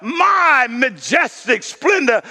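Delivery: slow, proud shout; boastful king. Acted. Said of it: "here's arrogance".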